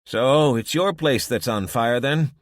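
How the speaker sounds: blunt and matter-of-fact; gruff old woman. A narrator's reading. Irma talks to Abby.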